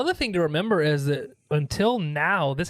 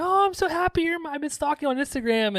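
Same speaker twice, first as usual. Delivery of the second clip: much higher, gushy starstruck voice; a hint of a breathless fangirl; gushing fan; 11 semitones higher, faster than usual. Retelling the cocaine sister's entrance, mock gushing fan voice. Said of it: "it's like".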